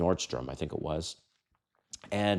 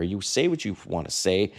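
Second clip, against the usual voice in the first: usual speaking voice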